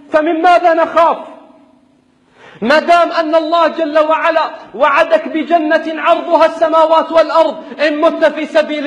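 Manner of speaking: fiery preaching. Acted. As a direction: preach it with rising fervor. Rousing sermon to fighters.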